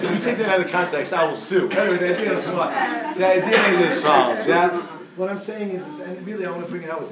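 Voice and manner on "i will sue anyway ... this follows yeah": ordinary talk, nothing marked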